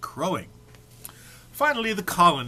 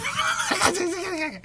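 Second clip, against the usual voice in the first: burst of laughter. Real, not acted. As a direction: explode into a high cackle of laughter.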